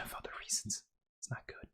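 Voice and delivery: hushed, voiceless aside; softly whispered afterthought. Real, not acted. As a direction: drop to a whisper as if confiding. Trails into an aside.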